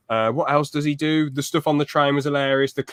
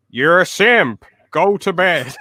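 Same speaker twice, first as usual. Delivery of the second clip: loud, higher imitated telling-off; mock scolding; 5 semitones higher, 6 dB louder, slower than usual. Fan imitating nan on the stream, mock scolding. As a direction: deliver a punchy mock reprimand.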